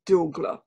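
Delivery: "Dougla" repeated clearly as DOO-gla with a falling close; "Dougla", first syllable stressed, falling tune; careful pronunciation. Shown as a demonstration. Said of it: "pronounce for me again and for our listeners".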